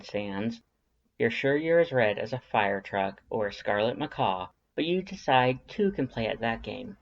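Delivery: ordinary delivery, nothing marked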